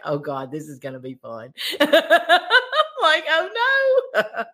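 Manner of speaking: high laughing despair, mock dread; laughing panic. Contestant laughing at her own baking panic, mock dread. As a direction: laugh through a pretend wail of doom. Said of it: "I'm just like".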